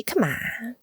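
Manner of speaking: hushed pep talk; whispered encouragement. Pretend. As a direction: whisper it like coaxing someone on. Whispered mock encouragement to the villain.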